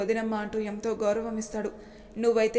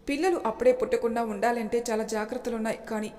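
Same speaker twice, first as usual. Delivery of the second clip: ordinary delivery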